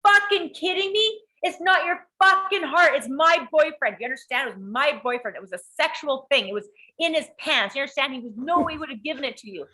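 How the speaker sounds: high, loud, re-enacted furious rant; retold furious yell. Mother losing it at her daughter over boyfriend's heart, re-enacted yelling. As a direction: yell it out, outraged, easing in volume but staying high. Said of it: "like, yelled".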